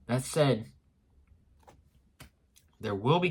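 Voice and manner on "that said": plain reading voice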